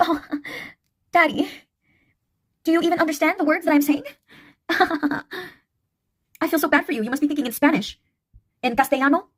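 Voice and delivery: high, sugary-polite mock-innocent voice with giggles; polite feminine tone. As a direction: play the sweet, polite girl while insulting him. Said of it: "I would make my tone real polite and feminine".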